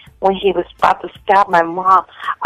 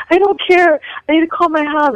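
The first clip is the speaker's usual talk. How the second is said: high, retold pleading; lightly acted desperate demand; frantic insistence; 9 semitones higher than usual. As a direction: insist urgently, pitched up. Acted insistence after nightmare.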